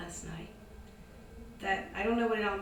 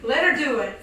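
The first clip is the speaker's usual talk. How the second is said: shout from a window; 4 semitones higher, 16 dB louder than usual. Retold or imitated.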